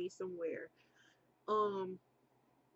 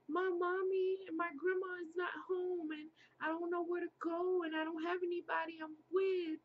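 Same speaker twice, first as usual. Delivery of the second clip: put-on higher childlike pleading voice; mild small-child plea, raised pitch; little girl; 8 semitones higher than usual. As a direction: voice your scared little self, higher. Child self voiced crying.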